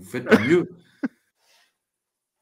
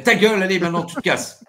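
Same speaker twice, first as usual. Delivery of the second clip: mock anger; 8 semitones higher, 7 dB louder than usual. Host snaps at grandpa.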